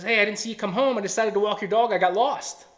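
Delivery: bright and loud, oblivious to the drama; imitating the friend. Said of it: "He goes".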